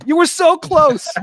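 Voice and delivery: loud, high, drawn-out mock despair; exasperated teasing. Real, not acted. Host ribbing guest for being a Yankees fan, exasperated. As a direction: stretch it out loudly in playful exasperation.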